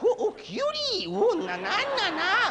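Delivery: very high, squealed mock swooning; hammed-up high cooing over a cutie; girl-crazy inner voice. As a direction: ogle someone in a squeaky falsetto. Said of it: "your little voice checks out".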